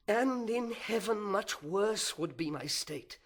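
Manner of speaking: a proud fallen angel brooding aloud, swinging from whispers to outbursts; fallen angel's soliloquy. Acted. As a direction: seethe with envy and wounded pride, then spit out the vengeance.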